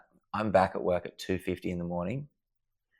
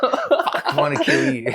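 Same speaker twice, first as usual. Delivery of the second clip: high, loud laughing "I wanna kill you"; laughing mock rage; 9 dB louder than usual. Real, not acted. Recalling Delta's untouched food, laughing high and loud mock rage. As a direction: laugh through the pretend fury.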